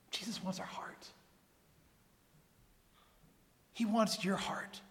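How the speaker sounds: near-whispered pulpit plea; softly pressing a truth on the congregation; hushed tender appeal. Real, not acted. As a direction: drop right down, soft and intimate. Preacher drops to tender appeal.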